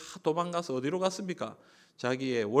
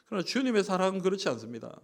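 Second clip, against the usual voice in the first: ordinary talk, nothing marked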